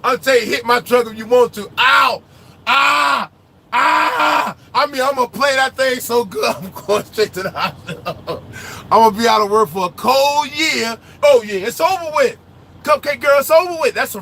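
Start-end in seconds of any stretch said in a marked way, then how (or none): none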